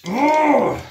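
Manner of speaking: loud, high "ugh" of annoyance; frustrated groan. Real, not acted. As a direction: groan at a bad roll. Frustrated at dice roll.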